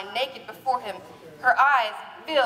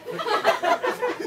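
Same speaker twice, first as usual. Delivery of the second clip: high giggle; breaking into giggles; laughing; 11 semitones higher than usual. Real, not acted. Reader laughs at own reading.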